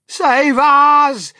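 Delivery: high, loud wail for help; shrieking old grandma begging rescue; terrified cry. A narrator's reading. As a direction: wail it out in panic. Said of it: "cried Grandma Josephine".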